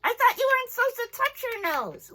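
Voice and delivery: high, loud puppet voice; a curious puppet objecting. Acted. Puppet questions the teacher.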